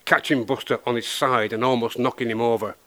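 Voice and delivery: usual speaking voice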